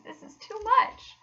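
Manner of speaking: high, loud burst of delight; ecstatic. Acted. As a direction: gasp it out, overwhelmed. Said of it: "cried the mole in ecstasies".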